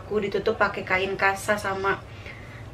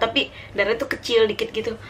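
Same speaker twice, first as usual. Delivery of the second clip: normal talking voice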